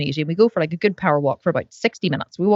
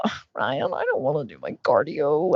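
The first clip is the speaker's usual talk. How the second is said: low, soft self-pitying moan; whiny moan; 5 semitones lower, 4 dB quieter than usual. Retold or imitated. Wife moaning softly to husband about doing cardio. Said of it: "I was just having a wee suffer".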